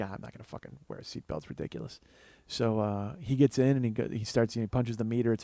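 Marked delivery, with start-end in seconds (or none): 0.0-2.0 muttered aside. Retold or imitated. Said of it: "I'm like".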